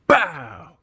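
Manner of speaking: loud sharp bow punch effect; loud mock punch impact; punch sound. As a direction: slam out the hit noise. Imitating the punch.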